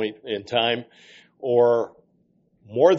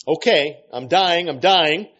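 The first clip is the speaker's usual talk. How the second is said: raised, louder demon voice; acted demon surrender; 5 semitones higher, 5 dB louder than usual. Demon giving in to the exorcists, raised acted voice. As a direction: cry out in mock surrender. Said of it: "growled a deep voice from within her".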